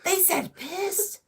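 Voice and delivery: high, drawn-out astonishment; mock disbelief. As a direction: stretch it out high in retold shock. Retelling his shock at the cartoon.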